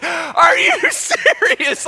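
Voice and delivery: high, slow, loud disbelief; mock outrage. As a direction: shout it as an exasperated wife imitation. Wife outraged at husband's reaction.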